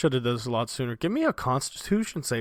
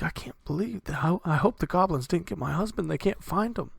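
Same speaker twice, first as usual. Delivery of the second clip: worried townswoman; 6 semitones higher, 4 dB quieter than usual. Acted.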